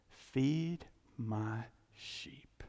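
very slow and soft; solemn, tender command. Retold or imitated. Risen Jesus charges Peter, slow and tender. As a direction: let each word land gently.

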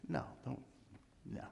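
ordinary delivery

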